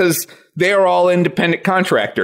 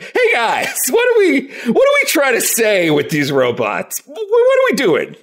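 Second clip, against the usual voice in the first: exasperated questioning; 5 semitones higher than usual. Acted. Critic asking what these robots say, acted high exasperation. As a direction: pitch leaping up in bafflement. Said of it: "And it's like".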